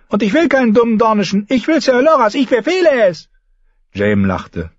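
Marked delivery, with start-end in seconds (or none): 0.1-3.3 put-on high, quick childish demands; petulant boy king. A narrator's reading. Boy king commands his mother to give him Loras, high and petulant. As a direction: order it like a stubborn little king.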